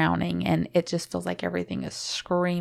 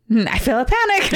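nervous laughter breaking through the words, higher and louder; emotional laughing; 8 semitones higher, 4 dB louder than usual. Real, not acted. Recalling a panic attack to her partner, nervous laughing. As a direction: laugh it out while fighting panic. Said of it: "I'm getting emotional".

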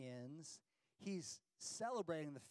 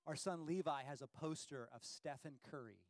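normal talking voice

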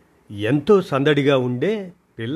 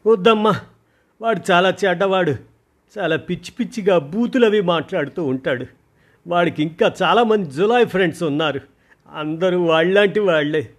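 much higher, louder panicked begging; frightened pleading; 8 semitones higher, 7 dB louder than usual. Acted. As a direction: beg her not to go. Daughter frightened, begs mother not.

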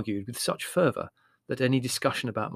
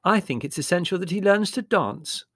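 higher, louder sister's voice; Margot, insistent; 7 semitones higher, 5 dB louder than usual. Acted.

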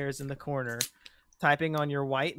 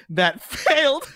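"That failed" drawn out, breaking into laughter; slow reveal, then a high laugh; dramatic laughing reveal; 13 semitones higher, 8 dB louder, slower than usual. Pretend. Acting out typing the title.

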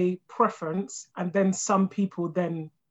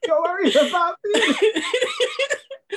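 laughing; 12 semitones higher, much slower than usual. Real, not acted. Laughing at the absurd story.

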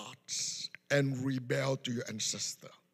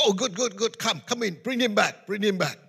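higher, louder delighted welcome; eager welcome; 6 semitones higher, 6 dB louder than usual. Retold or imitated. Grandfather eagerly welcoming the witch doctor, delighted. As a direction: usher him in eagerly.

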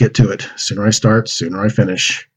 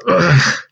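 disgusted ugh; 8 semitones higher than usual. Acted. Boy smells pig muck.

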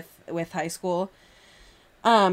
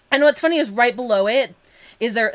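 ordinary talk

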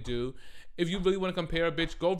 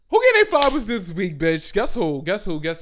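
loud high hype that fades on each guess who; hype-up segment call to viewers; excited hype call; 5 semitones higher, 12 dB louder than usual. Real, not acted. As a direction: announce the segment like a hostess. Segment intro calling out.

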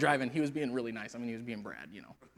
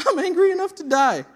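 loud, high, less shouted retelling; repeated angry quote; 14 dB louder than usual. Acted. Preacher repeating Jonah's words to the church, mock angry. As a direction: repeat it with amused disbelief. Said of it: "That's what he says".

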